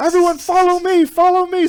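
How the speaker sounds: very high, loud call to the crowd; mock rallying shout. Leader calling stranded newbies to flee, high mock shout. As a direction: yell for everyone to come, hammed-up.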